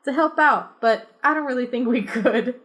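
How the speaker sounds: high, laughing apology; half-hearted explanation; sheepish kid. Acted. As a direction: kid backing out of helping.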